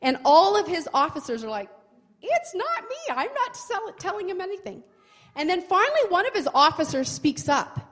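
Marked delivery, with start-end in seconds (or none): 2.2-4.8 high, nervous mock protest; nervous denial. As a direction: squeak out a jittery denial. Officers deny being the spy.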